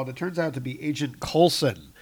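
usual speaking voice

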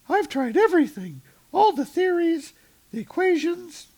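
put-on high, loud girly falsetto for Jane; hammed-up high voice for Jane; Jane's voice. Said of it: "Jane is like".

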